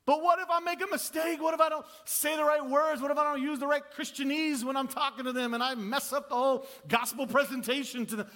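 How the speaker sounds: high, loud mock-panicked what-ifs; acted anxious worrying. Preacher voices believer scared of sharing faith, mock anxious.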